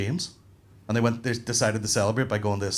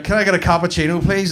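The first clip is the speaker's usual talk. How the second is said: louder, higher-pitched order; raised-voice acted request; customer at local counter; 6 semitones higher, 5 dB louder than usual. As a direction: pipe up over the counter. Same order, in local version.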